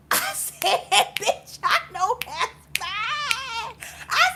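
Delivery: high, laughing through the words. Real, not acted. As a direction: cackle it out, delighted. Laughing at friend's comeback.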